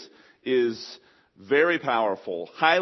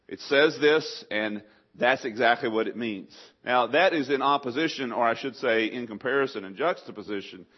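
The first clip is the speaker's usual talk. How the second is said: ordinary delivery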